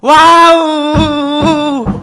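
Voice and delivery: long, high, loud drawn-out wow; toddler's wow. Retold or imitated. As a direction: stretch the wow out like a thrilled toddler. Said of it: "막 이러는 거예요".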